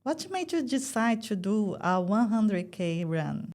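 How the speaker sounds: ordinary delivery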